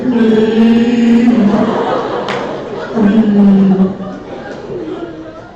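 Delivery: loud mock Chewbacca sob-howl; Chewbacca wail. Annie crying hard, mimicked as a loud Chewbacca howl. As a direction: howl like a choking Wookiee. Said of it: "like Chewbacca choking on something".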